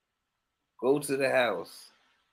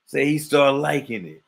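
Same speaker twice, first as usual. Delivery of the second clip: ordinary talk, nothing marked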